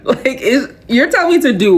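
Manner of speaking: high, disbelieving question; mock the viewer's disbelief; incredulous listener. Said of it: "You might be thinking".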